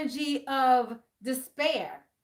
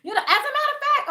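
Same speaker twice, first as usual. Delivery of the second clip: high laughing realization, much higher and louder; 13 semitones higher, 5 dB louder, faster than usual. Real, not acted. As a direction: break into laughter mid-sentence.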